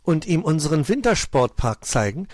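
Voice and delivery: taken aback; baffled. A narrator's reading. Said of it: "fragte Switchy verblüfft".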